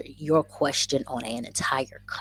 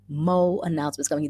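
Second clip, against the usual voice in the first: usual speaking voice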